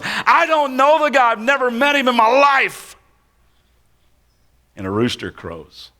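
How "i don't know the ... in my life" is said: very high, strained and furious; cursing denial. Acted. Peter cursing as he denies Jesus a third time, very high heated oath. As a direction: swear it's not true. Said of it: "Peter begins to call down curses".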